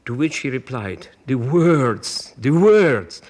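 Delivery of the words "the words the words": loud, swelling repeated cry; emphatic exclamation. Retold or imitated. Conductor demanding articulation from his singers, loud and emphatic. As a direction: hammer the phrase out like an impassioned maestro.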